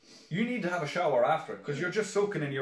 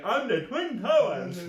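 lightly hammed-up, higher and louder; a raised, drawn-out mock announcement; playful boast; 7 semitones higher, 5 dB louder, slower than usual. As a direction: call it out playfully to egg the kids on. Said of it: "I was like".

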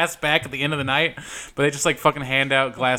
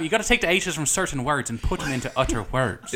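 mock Irish brogue, TH as T; Irish accent. Shown as a demonstration. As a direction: demonstrate the Irish accent playfully. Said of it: "The thing about Irish is".

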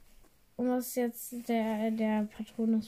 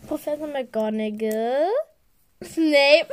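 usual speaking voice

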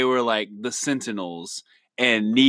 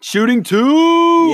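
announcer voice; 9 dB louder, much slower than usual. Acted. Segment name announced.

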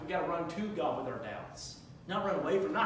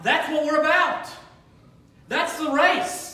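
loud, high, rising sermon climax; preaching at full force; 12 semitones higher, 15 dB louder than usual. Acted. Pastor rousing the church to make disciples, shouting. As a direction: preach it out, building to a roar.

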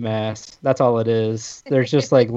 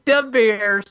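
The Bears in a hammed-up Chicago accent, pitched higher; mock Chicago accent; 10 semitones higher than usual. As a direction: play a Chicago sports fan in a put-on accent. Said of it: "that was pretty good".

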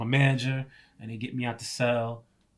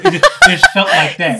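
laughing; 8 semitones higher, 17 dB louder than usual. Real, not acted. Laughing at his zombie panic.

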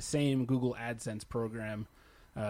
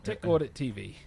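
lightly put-on British accent; 2 semitones higher than usual. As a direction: try a hint of a British accent. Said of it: "You need a British accent".

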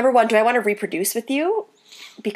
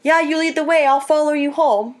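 mock-eager reply, pitched up; sarcastic agreement; 6 semitones higher, 3 dB louder than usual. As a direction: pretend to go along, sarcastically, higher voice. Imagined sarcastic reply to drivers.

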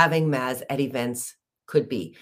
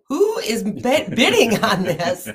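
peeking out, surprised; 5 semitones higher than usual. Retold or imitated. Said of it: "So I did one of these".

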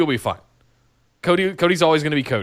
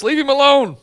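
high, louder leave him alone; mock pleading; 11 semitones higher, 4 dB louder than usual. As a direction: plead in a squeaky voice. Joking defence of injured wrestler.

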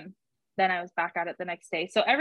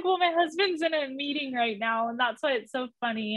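laughing explanation; 6 semitones higher than usual. Retold or imitated. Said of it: "I just am laughing".

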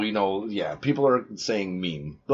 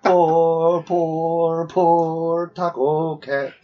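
mock pity; 6 semitones higher, 4 dB louder, much slower than usual. Mock pity for taco cat.

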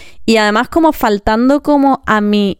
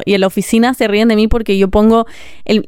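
normal talking voice

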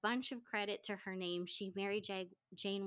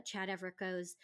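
ordinary talk

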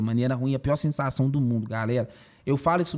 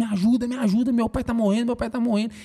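re-enacted high, urgent plea for help; desperate cry for help; 9 semitones higher, 3 dB louder than usual. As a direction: cry out for help in panic, much higher and a little louder. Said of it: "eu gritando, mano, desesperado". (grito desesperado de socorro, reencenado)

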